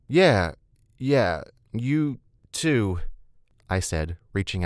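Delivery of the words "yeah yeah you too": slow, halting, louder reply; awkward, tongue-tied. Acted. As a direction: drag out each word, dazed and flustered. Smitten newcomer fumbles a greeting.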